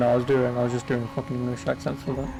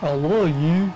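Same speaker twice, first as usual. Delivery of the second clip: hammed-up American bumpkin, higher pitched, words stretched; mock American accent; 10 semitones higher, slower than usual. Friend mocking muscle-car lovers for laughs, exaggerated American accent. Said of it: "I can do an American accent if you want".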